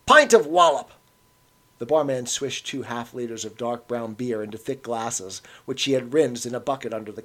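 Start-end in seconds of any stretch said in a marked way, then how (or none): 0.1-0.9 louder, pushy Cockney order; aggressive. A narrator's reading. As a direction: demand it of the barman. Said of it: "he added aggressively to the barman".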